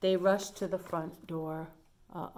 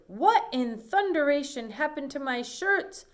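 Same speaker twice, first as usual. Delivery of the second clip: loud, raised storybook bellow; mock roar; 6 semitones higher, 5 dB louder than usual. Mr. Rogers finding his painted shirts, roaring. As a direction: bellow it like an outraged husband. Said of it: "Mister Rogers roared".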